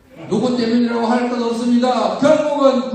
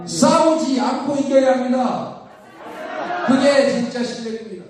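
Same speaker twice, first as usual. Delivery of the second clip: ordinary talk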